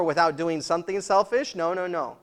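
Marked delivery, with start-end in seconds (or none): none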